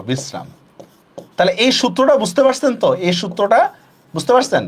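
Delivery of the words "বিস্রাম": বিশ্রাম with a hissed s before r, not sh; dental s. Shown as a demonstration. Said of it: "উচ্চারণে কিন্তু আমি দন্ত স দিব".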